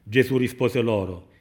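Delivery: ordinary talk, nothing marked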